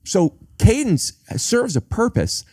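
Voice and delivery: ordinary delivery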